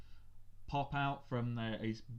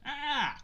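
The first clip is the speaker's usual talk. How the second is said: startled yelp; 13 semitones higher, 4 dB louder than usual. Acted. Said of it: "He goes".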